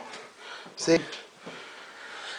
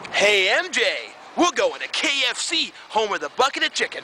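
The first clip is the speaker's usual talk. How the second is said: high, upbeat ad read; commercial announcer; 6 semitones higher than usual. Pretend. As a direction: sell it like a TV ad. Mock ad for KFC.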